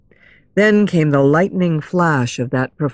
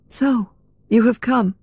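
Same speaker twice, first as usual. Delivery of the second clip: put-on higher, composed young-heroine voice; Christine's greeting; 5 semitones higher than usual. A narrator's reading. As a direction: greet him as if expected. Christine greets Raoul, unsurprised.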